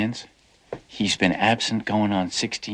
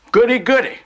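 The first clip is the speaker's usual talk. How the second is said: mock glee; 8 dB louder than usual. Mock cheer at others' adventures.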